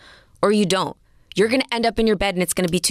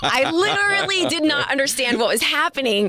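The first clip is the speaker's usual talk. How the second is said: laughing while talking, higher pitch; 8 semitones higher than usual. Real, not acted. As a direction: say it through a laugh. Laughs while recalling confusion.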